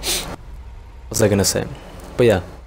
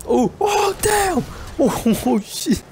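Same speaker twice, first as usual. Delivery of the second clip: very high excited cry, laughing; thrilled outburst; much slower than usual. Real, not acted. Gamer reacting to a big hit, high laughing outburst. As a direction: squeal it high through laughter.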